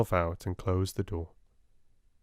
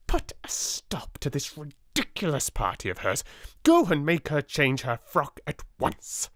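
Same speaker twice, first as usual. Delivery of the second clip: angry; 6 semitones higher than usual. A narrator's reading. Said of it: "Miss Minchin raging".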